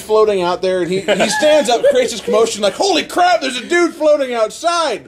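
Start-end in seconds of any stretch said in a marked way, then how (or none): 2.7-5.1 high-pitched mock alarm; alarmed retold cry. Kid in the story sees a floating man, alarmed. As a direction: cry out high, as if spotting something unbelievable.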